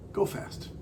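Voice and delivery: hushed, breathy go fast; whispered. Real, not acted. Emphatic whispered rule aside.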